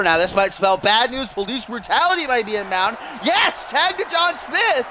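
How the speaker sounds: high-pitched wrestling call building to a peak; excited play-by-play. Real, not acted. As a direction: build from tense warning to big, high calls.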